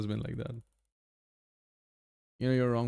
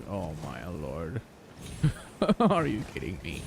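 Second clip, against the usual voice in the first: exasperated sigh; 5 semitones lower, 4 dB quieter than usual. Retold or imitated. Quoting own reaction to teacher.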